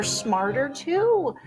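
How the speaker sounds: plain reading voice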